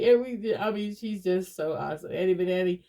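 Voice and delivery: normal talking voice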